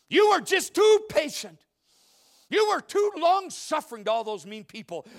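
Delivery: hammed-up complaint about too much love; mock scolding Jesus. Jesus mock-indicting believer for loving too much, loud high outrage. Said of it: "he's gonna look at you and say".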